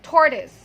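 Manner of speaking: loud TOR-tuhs for tortoise; short schwa at the end of tortoise; correct pronunciation. Shown as a demonstration. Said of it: "One more time".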